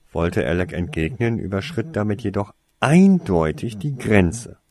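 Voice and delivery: ordinary delivery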